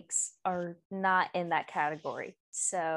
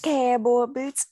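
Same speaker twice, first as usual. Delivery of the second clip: loud, higher Southern twang; 4 semitones higher, 8 dB louder, slower than usual. Shown as a demonstration. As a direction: belt it out with stretched Southern vowels. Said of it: "Can you say cowboy boots?".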